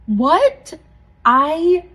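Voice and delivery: high, loud shocked outburst; retold shock, voice jumping up; stunned disbelief. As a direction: blurt it out as if stunned. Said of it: "I was frozen".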